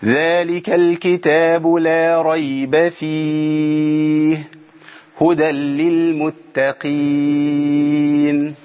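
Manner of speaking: slow, drawn-out tajweed recitation; Quran recitation. Shown as a demonstration. Teacher reciting verses to students, slow and drawn out.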